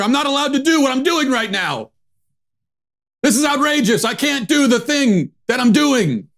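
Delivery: high, mock-shrill whine of the protester. Mocking the Portland protester in a high whine. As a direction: squeal it as a sneering parody of her. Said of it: "shouting about how she can't shout".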